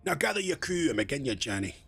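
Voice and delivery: a poor put-on Scottish burr on "gather" and "journey"; bad Scottish. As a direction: try a pirate, land in shaky Scottish. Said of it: "Sort of bad Scottish".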